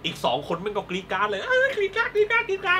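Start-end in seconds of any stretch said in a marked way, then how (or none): 1.5-2.8 much higher mock shrieking. Imitating girls screaming.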